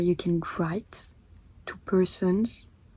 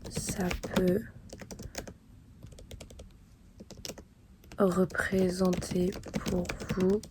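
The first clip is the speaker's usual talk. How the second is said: slow, halting words with long pauses; dictating while writing; much slower than usual. Real, not acted. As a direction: say each phrase as you write it down. Composes letter aloud while writing it.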